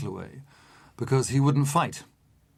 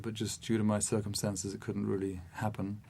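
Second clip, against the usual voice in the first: very soft, low retold confession; quiet, gentle voice; 3 semitones lower, 9 dB quieter than usual. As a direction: drop right down, imitating his gentle tone. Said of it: "In a very quiet and gentle voice".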